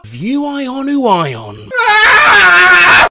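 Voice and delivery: high, loud shrieking cry; wild shout. Pretend. Reading the sound transcript aloud, loud shriek. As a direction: let it rip into a shriek.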